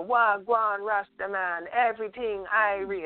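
slow, high-pitched mock-Jamaican greeting; fake Jamaican accent. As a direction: imitate a Tennessee kid trying to sound Jamaican. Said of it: "fake Jamaican accent".

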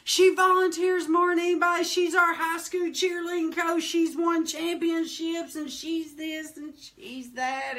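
a light preacher's praise; praising preacher. Retold or imitated. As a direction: boast about her from the pulpit. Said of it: "Pastor Parsley says".

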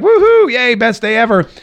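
very high whoop settling into a high cheer; mock cheering. Host imagines Atlanta fans celebrating, hammed-up excited cheer. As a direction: whoop it up like an imagined delighted crowd. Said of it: "everyone's gonna be like".

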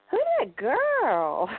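put-on high, slow little child voice; imitating a three-year-old asking; toddler voice. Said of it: "she said".

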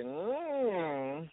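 drawn-out approving hum; savouring "mm". Retold or imitated. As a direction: let a high hum swell and linger. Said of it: "I was like".

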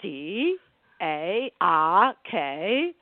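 Boston mother spelling it out, the last letters slow; Boston accent, slow letter-by-letter spelling; spelled in Boston accent. Retold or imitated. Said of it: "my mother goes".

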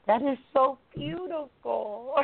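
high, slow, swooning praise; gushing delight. Real, not acted. Student swooning over the breath-as-gift idea, high and slow. Moved listener repeats praise.

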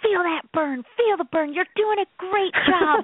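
high, pumped trainer cheer; mock fitness coach. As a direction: urge them on like a gym coach. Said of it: "motivate you and say".

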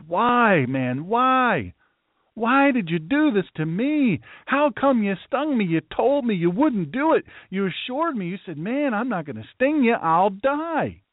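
high, drawn-out plea of betrayal; dying frog. Acted. Stung frog demands why from the scorpion, high acted pleading. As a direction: protest as the betrayed frog, pitched well up. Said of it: "The frog's in the process of dying".

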